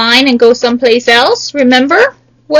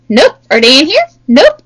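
usual speaking voice

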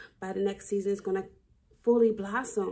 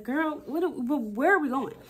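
breathless protest; 6 semitones higher than usual. Retold or imitated. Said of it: "I was out of breath".

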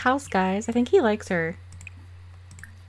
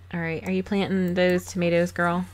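ordinary talk, nothing marked

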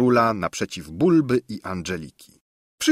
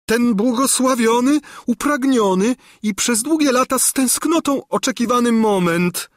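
put-on high, loud simpering old woman; gushing exclamation; 5 dB louder than usual. A narrator's reading. Old governess gushes at the king, high and loud. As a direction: cry out like a lovesick old hag. Gburja Furia speaks.

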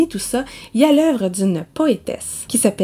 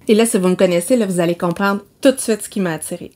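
ordinary talk, nothing marked

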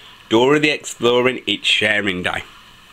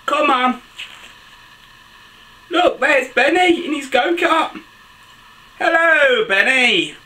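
high-pitched cheery hail to a friend; excited calling out; 13 semitones higher, 4 dB louder, slower than usual. Acted. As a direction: call out eagerly, pitched well up. Story heroine calls out to friend.

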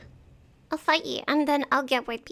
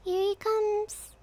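higher, slowly drawn out; slow, higher anticipation; 8 semitones higher, much slower than usual. Real, not acted. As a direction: stretch it out as he approaches. Watching a character approach.

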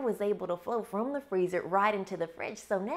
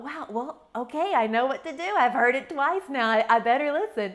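mild excited realization; 5 semitones higher, 6 dB louder than usual. Retold or imitated. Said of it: "I thought".

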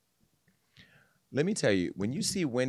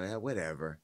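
Wendy's voice; 6 semitones lower, 9 dB quieter than usual. Retold or imitated. Host imitating Wendy brushing off a statement, lightly voiced. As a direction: toss it off the way Wendy would. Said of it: "This is how Wendy would talk".

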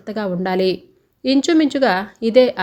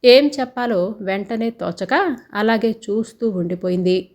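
ordinary delivery, nothing marked

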